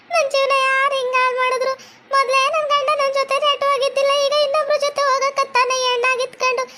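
wailing lament. Acted. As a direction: cry out over a ruined life, drawing out the last words.

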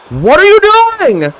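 high, drawn-out shocked cry; stunned yelp. Real, not acted. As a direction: stretch it out in disbelief. Shocked at opponent's move.

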